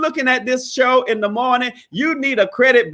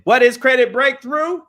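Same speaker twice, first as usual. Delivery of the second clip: calling out to the crowd, pitch climbing; lightly preached chant; preacher call and response; 4 semitones higher than usual. Acted. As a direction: lead the church response.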